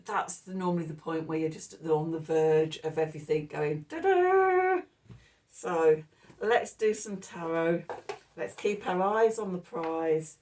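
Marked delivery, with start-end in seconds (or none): 3.9-4.8 high, loud sung-out "ta-da"; triumphant fanfare. Acted. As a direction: sound a big reveal fanfare, held long. Imitating a triumphant reveal fanfare.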